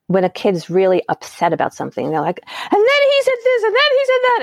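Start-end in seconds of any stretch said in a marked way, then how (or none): 2.5-4.4 put-on high, whining child voice, a big jump up; whiny kid. Upset kid venting to mom, high whining. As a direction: complain like an upset kid retelling a fight.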